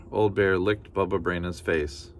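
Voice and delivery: ordinary delivery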